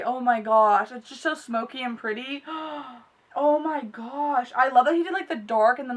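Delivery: normal talking voice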